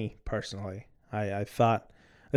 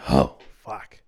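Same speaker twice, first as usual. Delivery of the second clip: annoyed curse; 9 semitones lower, 7 dB louder than usual. Real, not acted. Camera stopped recording mid-talk.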